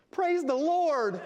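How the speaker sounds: loud, high, drawn-out Southern American whoop; thick Southern American accent. Retold or imitated. Tearful lady in café praising God, thick Southern American accent. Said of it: "in this thick Southern American accent".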